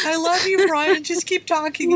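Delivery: a mock adoring plea; lightly voiced lovestruck friend; imitated Jen. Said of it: "Jen's like".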